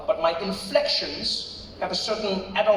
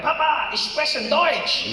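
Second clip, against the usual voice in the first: high, drawn-out proud announcement; imagined big reveal in German; excited announcement; 9 semitones higher, 4 dB louder, much slower than usual. Pretend. Son surprising his dad with German, excited announcement.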